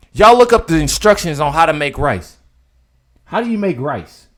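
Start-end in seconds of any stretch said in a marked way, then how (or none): none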